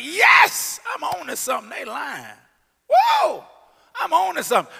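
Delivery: high, loud, thrilled cry; excited shout. Pretend. Believer delighted at the lies, high and loud. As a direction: break into a joyful whoop. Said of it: "You should be like".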